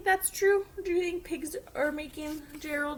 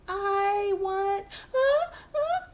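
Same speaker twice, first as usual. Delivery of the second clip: breathy uh uh rising higher before a sneeze; building sneeze; 7 semitones higher, 8 dB louder, much slower than usual. Acted. Gerald trying to answer Piggy, about to sneeze. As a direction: hold back a sneeze that keeps coming.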